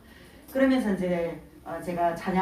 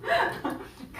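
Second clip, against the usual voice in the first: a burst of high, real laughter; 14 semitones higher, 3 dB quieter than usual. Real, not acted. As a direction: laugh out high at your own joke. Laughing at her own joke.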